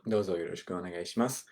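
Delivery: quick soft fluent version; natural speed Japanese; fast quiet run-through. Shown as a demonstration. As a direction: rattle off "Douzo yoroshiku onegai shimasu" fast and soft. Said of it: "Just like that".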